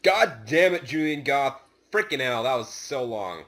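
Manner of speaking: ordinary delivery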